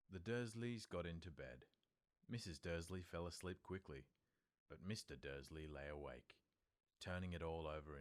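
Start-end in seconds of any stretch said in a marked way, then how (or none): none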